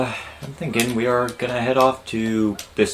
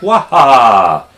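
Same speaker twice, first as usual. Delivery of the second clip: Mario impression; 5 semitones higher, 10 dB louder than usual. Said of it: "he was like".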